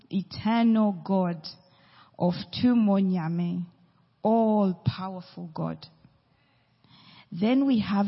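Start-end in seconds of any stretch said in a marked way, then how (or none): none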